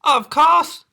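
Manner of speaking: high and loud, eager; eager cry. Acted. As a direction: call out gladly. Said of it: "cried Little Red".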